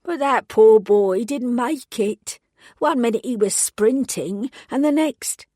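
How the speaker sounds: put-on slow and sad in a London accent. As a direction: witness mourning the boy in a London accent. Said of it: "she spoke with a London accent".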